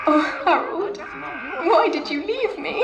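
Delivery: high anguished cry of loss; tragic heroine sorrow. Acted. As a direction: wail his name in despair. Edith laments Harold.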